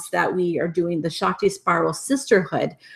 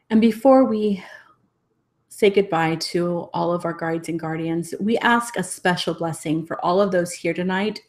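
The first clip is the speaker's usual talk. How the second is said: guided meditation blessing. Real, not acted. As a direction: guide them gently into a closing blessing, breathing between phrases. Leads closing meditation and blessing.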